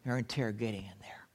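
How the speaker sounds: low, fast whisper; man at a courtyard fire whispering so the house won't hear; whispered aside. Acted. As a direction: hiss it quickly under your breath, glancing toward the house. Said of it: "Something along this line".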